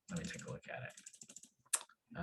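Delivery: barely audible aside to himself; muttering to himself. Real, not acted. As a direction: mumble it under your breath while busy. Muttering to himself while downloading.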